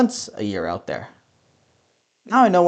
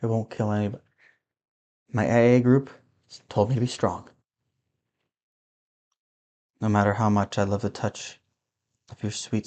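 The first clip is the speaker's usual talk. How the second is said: low, soft boozy mumble to himself; a sad drunk talking into his glass; drunk muttering; 7 semitones lower, 7 dB quieter than usual. Acted. Drunk man muttering before the show.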